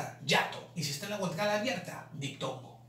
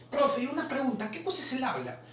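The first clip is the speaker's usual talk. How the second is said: higher-pitched pupil interrupting with a question; imitated student's query to the teacher; student asking; 6 semitones higher than usual. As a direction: pipe up like a curious student.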